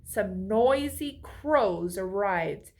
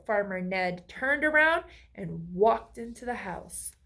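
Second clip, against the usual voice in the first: usual speaking voice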